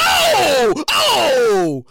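wild laughing shout; shrieking laugh. Real, not acted. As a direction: cackle and holler. Screaming laugh.

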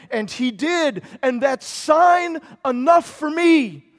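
loud, rising sermon proclamation; a fiery preacher declaring Jesus' promise; full-force preaching. Acted. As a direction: preach it out to the whole room. Preaching on the empty tomb.

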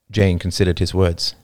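ordinary delivery

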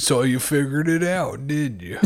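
lightly voiced, slow villain gloating; a hint of a taunting radio villain; mocking villain. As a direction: laugh at them as the unmasked villain. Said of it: "got a whole different accent".

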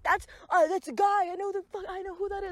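high, loud imitation of mom; squeaky mocking mom impression. Teen retelling his mom's reaction, high mocking mom voice. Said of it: "and my mom being like".

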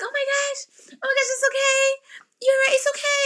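very high, fussing imitation of reassurance; imitated comforting girl. Girl comforting a crying patient, high imitated reassurance.

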